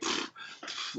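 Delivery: short, soft puffs of air; dismissive pfft. Acted. Medium voices spirit brushing off attempts at control, dismissive pfft. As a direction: blow it off with a mock raspberry-like pfft.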